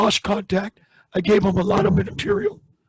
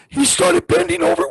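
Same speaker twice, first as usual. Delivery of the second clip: ordinary delivery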